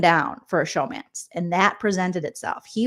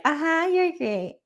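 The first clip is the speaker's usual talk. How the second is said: slow, higher, mock-sweet uh-huh; fake enthusiasm; 8 semitones higher, slower than usual. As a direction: humour him with a hollow compliment. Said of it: "she's just like".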